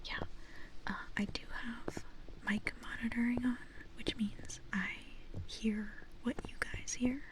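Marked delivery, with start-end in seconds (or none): none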